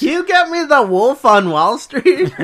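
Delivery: loud, high, drawn-out mom voice; hammed-up mother mangling a film title; mock mom. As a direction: slowly mimic a clueless mother, half laughing. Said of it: "my mom".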